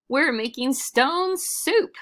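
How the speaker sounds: high, slow showman announcement; grand announcement. Acted. Traveler announcing stone soup to the crowd, grand and high. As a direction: present it like a magic trick.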